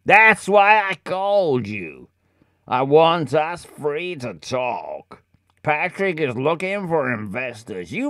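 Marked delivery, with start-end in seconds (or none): none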